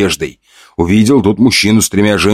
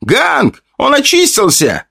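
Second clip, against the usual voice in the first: high, excited shouting; joyful yell; slower than usual. A narrator's reading. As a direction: shout it across the street. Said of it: "Он такой горластый".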